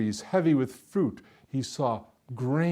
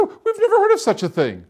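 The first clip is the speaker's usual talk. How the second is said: very high, loud disbelief; incredulous protest; 9 dB louder than usual. A narrator's reading. Villagers hearing of nail soup, loudly incredulous. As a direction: squawk in astonished objection.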